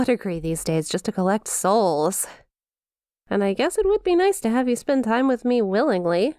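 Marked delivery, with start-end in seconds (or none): none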